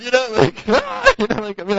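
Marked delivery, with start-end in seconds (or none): 0.7-1.1 high mock shriek; startled yelp. Preacher acting out his own panic, high mock shriek. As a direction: squeal like you got touched. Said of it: "I'd be like".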